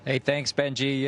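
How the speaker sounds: usual speaking voice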